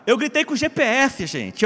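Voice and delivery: normal talking voice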